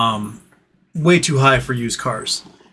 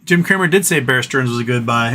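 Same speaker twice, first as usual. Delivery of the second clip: ordinary talk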